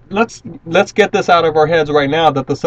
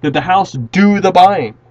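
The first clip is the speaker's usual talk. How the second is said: rhetorical question; 3 semitones higher, 5 dB louder than usual. Shown as a demonstration. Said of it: "let me ask you a question".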